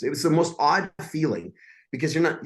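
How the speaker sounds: ordinary talk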